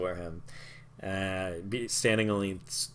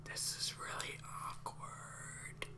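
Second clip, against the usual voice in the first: unvoiced aside whisper; 16 dB quieter, slower than usual. Halfling whispering an awkward aside to the party. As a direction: hiss it to the party under the breath.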